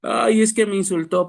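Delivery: high, whiny hurt complaint; mock whimpering complaint. Preacher imitates a hurt believer whining about insults, mock tearful. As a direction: whine like a wounded believer. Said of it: "Y usted ahí está llorando".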